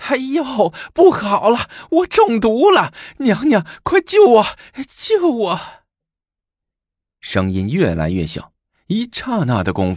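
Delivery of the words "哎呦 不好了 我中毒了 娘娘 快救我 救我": 被毒倒的太监，尖声高叫呼救; high, loud, desperate yelling in agony; pained cries for help. A narrator's reading. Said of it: "大叫着". (中毒后痛苦地高声呼救)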